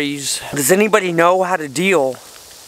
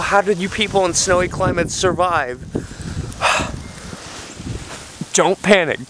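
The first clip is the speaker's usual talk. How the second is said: panicked; 4 semitones higher than usual. Acted. Panicking, calming himself.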